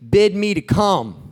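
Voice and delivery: slow, loud plea to the Lord; loud, drawn-out challenge; Peter calling out. Acted. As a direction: call across the water, stretching each word. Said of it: "he's frustrated".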